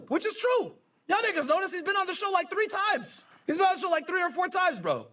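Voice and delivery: high-pitched, insistent rebuttal; indignant protest. Real, not acted. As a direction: break off reading to protest, pitched right up. Indignant aside rebutting the message.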